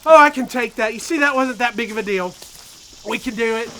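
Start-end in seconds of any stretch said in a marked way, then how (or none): 0.0-2.0 breathless excitement. Real, not acted. Just doused with ice water.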